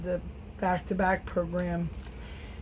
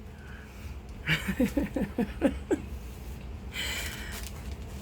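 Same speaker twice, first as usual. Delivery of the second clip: long breathy laughing fit; real laughter trailing off; long giggle; 4 semitones higher, 5 dB quieter than usual. Real, not acted. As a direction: giggle helplessly, then let it die out. Laughing at her own story.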